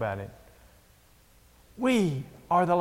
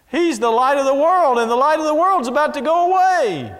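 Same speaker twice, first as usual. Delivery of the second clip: higher and louder, proclaiming to the congregation; preaching climax; 9 semitones higher, 5 dB louder than usual. Real, not acted. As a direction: build to a full-voiced pulpit cry.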